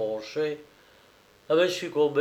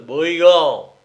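louder, odd-voiced cry; shouted insult; 3 semitones higher, 10 dB louder than usual. A narrator's reading. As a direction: yell it as the strongmen. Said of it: "crièrent les Hercules".

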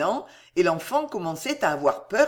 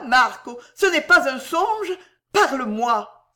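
rising higher and louder, breathless questions; mad with joy; 5 semitones higher, 4 dB louder than usual. A narrator's reading. Mother reunited with her son, wild with joy. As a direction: pour out questions in overjoyed disbelief. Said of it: "folle de joie".

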